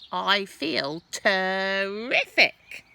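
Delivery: put-on high, loud gloating old woman; grandma bragging loudly from the roof; boastful Grandma. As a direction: crow about your magic powers. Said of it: "she answered".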